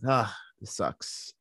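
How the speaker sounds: low, slow grumble under the breath; muttered complaint. Retold or imitated. Grumbling to himself, stuck behind the chase car, low and slow. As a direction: sulk it quietly to yourself. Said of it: "And I'm like".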